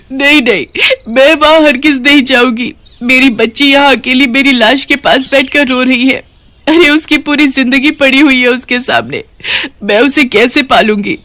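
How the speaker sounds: mother begging the witch queen through tears; crying. Acted. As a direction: sob out your refusal. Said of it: "तुम रो क्यों रही हो?".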